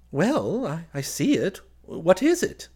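high, loud, hesitant bafflement; puzzled cousin. Acted. Puzzled cousin humours the narrator.

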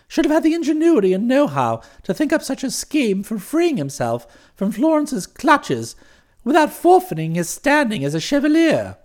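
plain reading voice

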